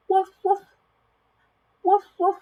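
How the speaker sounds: high, loud, short woofs; punchy playful barking in pairs; dog bark. Acted. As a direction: bark like a storybook doggy. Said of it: "The doggy says".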